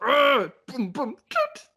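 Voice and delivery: high, loud grunts punching the wheel, then a horn toot; mock rage and horn. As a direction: act out angry wheel punches. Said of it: "It's like".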